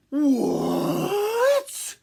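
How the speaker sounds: high, loud shocked granny; surprised cry. Acted. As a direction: cry out in alarm. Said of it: "cried Granny".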